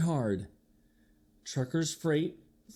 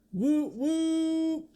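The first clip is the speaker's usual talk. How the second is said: train whistle woo; 12 semitones higher than usual. Acted.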